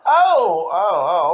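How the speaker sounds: sharp loud yelps of pain; real pain. Real, not acted. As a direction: yelp as the bird bites. Bird bites the speaker.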